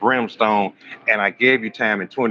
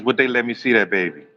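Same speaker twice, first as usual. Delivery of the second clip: ordinary talk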